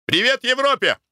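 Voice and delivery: high tipsy toast, "Европе" ringing out; exclaiming. A narrator's reading. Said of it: "воскликнул".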